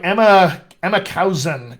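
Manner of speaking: ordinary talk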